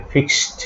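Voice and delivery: "ফিক্সড" said as "fikst"; final -ed as T. Shown as a demonstration. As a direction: end "ফিক্সড" with a t, no d. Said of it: "ট এর মতো উচ্চারণ হবে". ("ফিক্সড"-এর শেষে ট উচ্চারণ)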